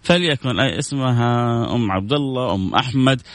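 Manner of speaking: ordinary delivery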